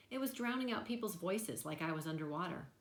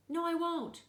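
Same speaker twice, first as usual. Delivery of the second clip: much higher, louder flat refusal; defiant shout; 12 semitones higher, 7 dB louder than usual. A narrator's reading. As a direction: yell no at the doctor. Boy refusing the hearing aids.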